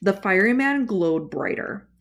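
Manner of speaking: ordinary delivery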